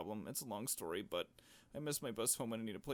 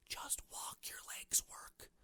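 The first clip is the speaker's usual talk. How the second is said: unvoiced, softer whisper; whispered reply; 5 dB quieter, slower than usual. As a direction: whisper the answer back. Friend on phone, dismissive.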